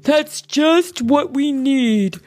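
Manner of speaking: loud, slow, high-pitched bear; emphatic encouragement; approving. A narrator's reading. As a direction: welcome the help heartily. Bear Bear welcomes Rabbit's help.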